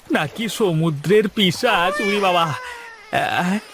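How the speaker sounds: a boatman scared by his own thought of a sea demon; frightened. Acted. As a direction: spook yourself and yelp.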